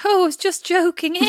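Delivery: high, small pleading whine; mock your own plaintive little protest. Woman mimicking herself after a failed joke, plaintive little voice. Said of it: "I heard my little plaintive voice".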